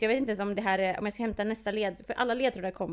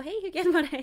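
cashier voice; 7 semitones higher than usual. Retold or imitated.

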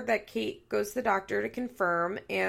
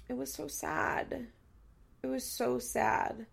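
real sadness, slow and higher-pitched; tearing up; 4 semitones higher, slower than usual. Real, not acted. Podcaster moved by the recap she is giving, tearful. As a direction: say it slowly, close to tears. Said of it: "this is making me tear up".

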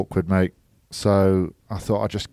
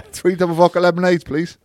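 higher, cheerful mock order; mock bar order; 10 semitones higher, 3 dB louder than usual. Retold bar order, high.

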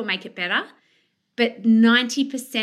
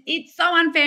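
high, louder mock child complaint; mock whiny child; 7 semitones higher, 4 dB louder than usual. Coach voicing a sulky child, mild whine. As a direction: whine it like a wronged kid. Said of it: "And then your child says".

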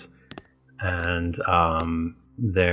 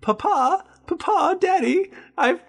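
put-on very high-pitched, childlike falsetto; little Tom; faster than usual. Tom showing Daddy a puzzle piece, squeaky falsetto. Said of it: "and Tom is like".

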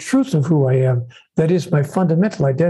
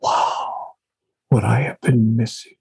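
acted realization; 5 semitones lower than usual. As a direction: play a hushed dawning realization. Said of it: "you will realize".

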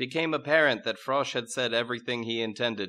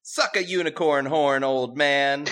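put-on louder, higher bratty teen girl; bratty princess; 5 semitones higher, 7 dB louder than usual. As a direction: sneer it at your old father. Rebellious princess sneers at king.

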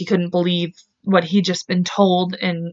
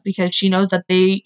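usual speaking voice

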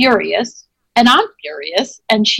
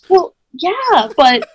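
higher, slowing, exasperated comeback; mock-exasperated protest, pitched up; retold indignant retort; 6 semitones higher, slower than usual. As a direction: push back as if it should be obvious. Retold angry retort to friend.

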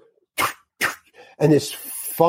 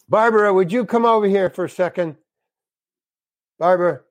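higher-pitched call across the set; 5 semitones higher than usual. Retold or imitated. Director summoning Barbara to the actor, calling out. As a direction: call someone over, imitating a director.

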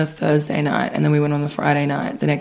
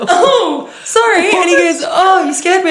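high, loud retold exchange; mock startled voices; 12 semitones higher, 3 dB louder than usual. Retelling the man's scared reply in a high voice. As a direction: replay the awkward back-and-forth.

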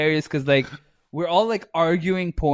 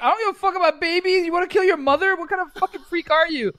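high, fast outraged rant; outraged yelling; faster than usual. Retold or imitated. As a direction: shriek in mock disgust. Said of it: "my friend's like".

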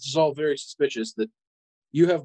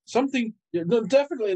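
high, overly cheerful babble; nervous cover-up; 8 semitones higher than usual. Acted. Son to father, hiding his suspicion, fake cheerful. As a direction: paper over the awkwardness with fake cheer.

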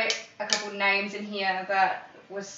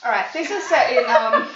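laughing; 3 semitones higher, 10 dB louder than usual. Real, not acted. Laughing over the pronunciation.

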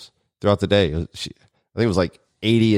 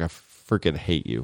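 muttered retold annoyance; 5 semitones lower, 7 dB quieter than usual. Retells wife's annoyed reply.

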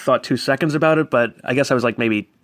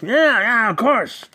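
high-pitched slurred mimicry; loose, slurry put-on voice, pitched up; 9 semitones higher, slower than usual. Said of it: "And he's like".

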